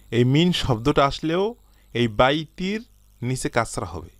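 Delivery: ordinary delivery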